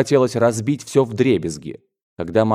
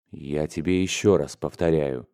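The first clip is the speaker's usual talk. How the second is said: low, soft, controlled menace; deliberate, emphatic; 5 semitones lower, 5 dB quieter than usual. A narrator's reading. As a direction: weigh each word in a lowered, quiet voice. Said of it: "делая ударение на каждом слове".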